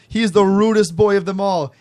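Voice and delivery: single him out in a put-on Jamaican accent; mock Jamaican accent. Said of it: "points at me and goes".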